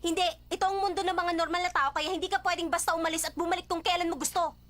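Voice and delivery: loud, high-pitched lecture in a quarrel; angry. Acted. Scolding someone who comes and goes at will, angry. Acted quarrel over coming and going.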